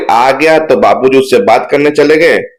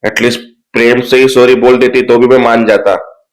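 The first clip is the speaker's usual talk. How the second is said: usual speaking voice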